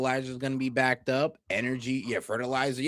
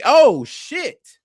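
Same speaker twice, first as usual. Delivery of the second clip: shocked outburst; 8 semitones higher, 10 dB louder than usual. Real, not acted. Shock at oil price chart.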